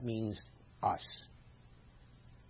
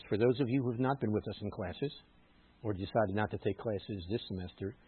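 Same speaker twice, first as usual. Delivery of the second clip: usual speaking voice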